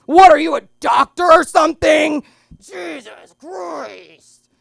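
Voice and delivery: slow, loud shouting guy voice; gruff man voice. Retold or imitated. Comic turning into a man yelling at the doctor. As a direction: bellow it like a rowdy man. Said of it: "I just, like, became this man".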